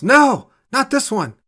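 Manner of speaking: high, loud desperate shout; yell. A narrator's reading. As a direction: scream to stop the gun. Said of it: "She touches the screen and yells".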